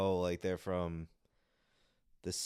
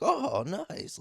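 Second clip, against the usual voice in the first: much higher squeaky "Oh, nice" with a light lift; mock delighted reaction; 4 dB louder than usual. Imitating how other nationalities respond, mock chirpy. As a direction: play it as a breezy, delighted shrug. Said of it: "you're like".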